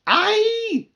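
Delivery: very high, loud shrieking cry; screech. Acted. Sister screeching at a bee on her shoulder. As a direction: shriek in fright. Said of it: "Chrissy screeched so loud".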